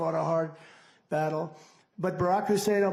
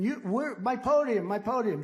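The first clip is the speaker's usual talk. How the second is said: higher, mock panicked yell; mock screaming; 6 semitones higher than usual. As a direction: squeal it like a rival who lost his podium. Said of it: "DeSanctimonious is screaming".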